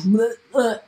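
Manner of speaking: imitated retching noise; mock gagging. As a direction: act out gagging on a bad taste. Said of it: "I'm gagging".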